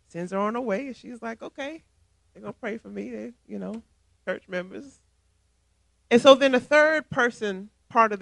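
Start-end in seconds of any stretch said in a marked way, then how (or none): 1.4-5.0 much softer, half to herself; resigned aside. Retold or imitated. The brushed-off member muttering to herself, resigned. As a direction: shrug it off quietly.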